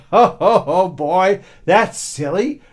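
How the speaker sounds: put-on passer-by laughs loudly at the foolish boy; laughing passer-by. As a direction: boom it out laughing. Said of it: "laughed out loud".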